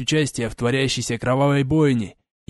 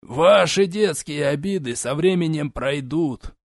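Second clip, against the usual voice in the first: slow, patronizing envoy; condescending; 4 semitones higher, slower than usual. Acted. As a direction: brush her off like a child. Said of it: "снисходительно изрёк посол".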